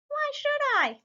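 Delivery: high, squeaky cartoon truck. Parent reading to kids, tiny truck squeaks. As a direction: pipe it up like a tiny toy truck. Truck character voiced in story.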